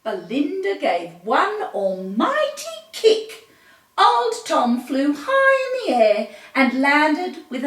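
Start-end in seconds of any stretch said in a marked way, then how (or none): 4.0-7.6 excited. A narrator's reading. Tom kicked into air.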